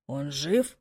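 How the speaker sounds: deep, quiet growling man; a low predator's question; low beast voice. Acted. (низкий звериный голос)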